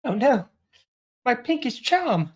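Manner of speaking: high, hammed-up dismay; mock dismay. British girl hears her makeup is sabotaged, mock dismay. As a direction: lament it in a high, put-on voice. Character reacts to ruined makeup.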